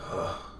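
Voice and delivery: imitated huff in someone's face; exasperated huff. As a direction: blow out a soft, irritated sigh. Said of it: "huffs in my face".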